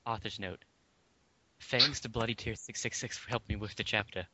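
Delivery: usual speaking voice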